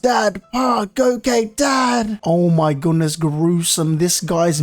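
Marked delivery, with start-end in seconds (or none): 0.0-2.2 high, mock-grieving calls; hammed-up weeping for a father; mock tearful cry. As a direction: act out holding back tears. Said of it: "trying to hold back tears".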